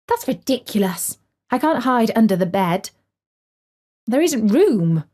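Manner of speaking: understated cross outburst; cross exclamation. A narrator's reading. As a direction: exclaim it with mild annoyance. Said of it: "she exclaimed".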